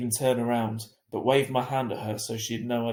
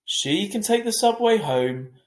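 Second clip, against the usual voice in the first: voicing Dad; 9 semitones higher, 7 dB louder, slower than usual. A narrator's reading. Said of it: "said Dad".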